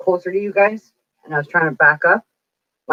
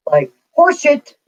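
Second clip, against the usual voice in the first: scoffing; 9 semitones higher, 4 dB louder than usual. Real, not acted. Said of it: "scoffing at the nurse's remark".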